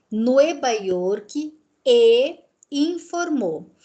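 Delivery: slow Spanish reading of "Nueva York e informou". Shown as a demonstration. Teacher reading the Spanish text aloud, slow and careful. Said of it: "olha lá".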